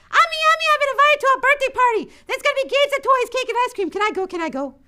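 put-on high, fast, eager child begging; a young girl bursting with excitement to ask mom; high excited child's voice; excited little girl.

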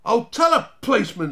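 put-on high, loud boyish threat; stout. A narrator's reading. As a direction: stand up to them stoutly. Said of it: "said Barney stoutly".

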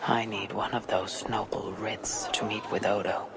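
hushed private whisper to herself; whispered thought. Acted. Eivor whispering to herself while scheming to get a writ. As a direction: murmur the plan under her breath.

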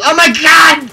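high, loud panicked yell; panicking gamer. Real, not acted. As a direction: scream in shock mid-sentence. Sudden firefight surprise.

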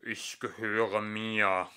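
slow, tinny robot pout; a sulking machine insisting, drawn out; langsam, blechern und trotzig; squeaky metallic voice. A narrator's reading. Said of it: "eine piepsige Stimme metallisch".

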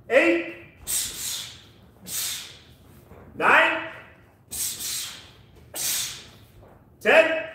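sharp, loud, high count calls; boxing coach calling combos. Real, not acted. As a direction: bark the numbers out to the class.

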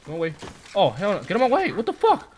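high, loud frantic yelling; panicked yelling. Real, not acted. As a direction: shout in panic while running away. Fleeing monsters in panic.

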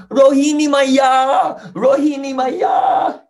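put-on high, long-drawn child's call; loud calling shout. Krishna calling his other mother for help, drawn-out shout. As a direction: call out far, stretching the last syllable. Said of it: "Krishna was loudly shouting".

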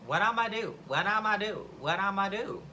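mock dithering to oneself; self-questioning. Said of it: "I thought to myself".

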